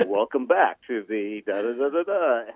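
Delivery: big radio announcer welcome trailing into nonsense syllables. Retold or imitated. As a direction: put on your best announcer voice. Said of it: "put on my best announcer voice".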